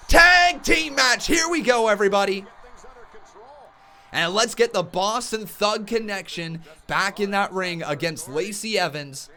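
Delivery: high, loud hyped wrestling commentary; live play-by-play. Acted. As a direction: call the brawl like a ringside announcer.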